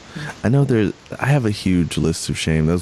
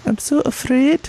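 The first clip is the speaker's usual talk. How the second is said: much higher, dainty Scottish voice; delicate Scottish accent. Shown as a demonstration. Demoing the Scottish accent he finds cute, much higher. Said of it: "I can't even do it".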